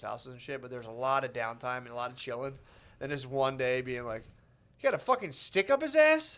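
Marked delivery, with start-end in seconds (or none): 4.8-6.4 high-pitched mock disbelief; incredulous outburst. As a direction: blurt it high, as if hearing outrageous news. Acting out agent's shocked reaction.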